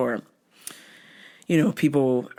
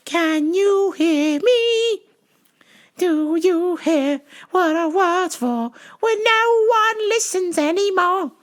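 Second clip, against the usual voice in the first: high, slow mock preaching; preachy old lady; 3 dB louder, slower than usual. Old caller preaching to clerk, high and slow.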